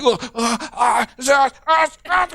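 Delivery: high, straining little uh uh sounds; a kid straining, pitch climbing; straining grunts. Retold or imitated. Kid straining to answer the teacher, rising strained grunts.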